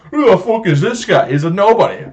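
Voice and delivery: loud, high-pitched incredulous jeer; exaggerated mocking disbelief. Customer jeering at manager's picture, exaggerated mocking disbelief. Repeats the mockery, escalating.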